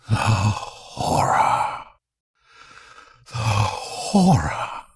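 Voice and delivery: slow, breathy dying whisper; haunted whisper. Acted. Marlow hearing Kurtz's last words, slow haunted whisper.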